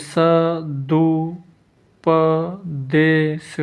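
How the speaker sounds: सदुपदेश very slowly, स-दु-प-दे-श; slow spelling-out of सदुपदेश with gaps; syllable-by-syllable dictation. Shown as a demonstration. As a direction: draw out each syllable of सदुपदेश.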